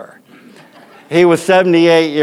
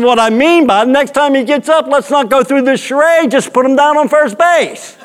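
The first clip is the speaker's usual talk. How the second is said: high, sustained sarcastic rant; mock yelling; 9 semitones higher than usual. Pitcher tells off the umpire over the walk, mock-angry rant. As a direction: let the retort pour out, pitched right up. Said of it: "we're yelling".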